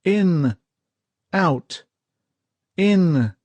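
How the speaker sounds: high, loud drill-like calls; officer calling the breathing rhythm; yelled commands. Acted. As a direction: shout each breath command. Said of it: "yelled the officer".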